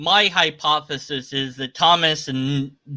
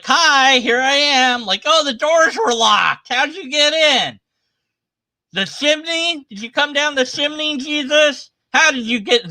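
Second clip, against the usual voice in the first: higher, hammed-up voices of startled friends; mock surprised disciples; 10 semitones higher than usual. Mocking Thomas and Jesus, hammed-up startled voices. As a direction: play both sides of a silly scene, up high.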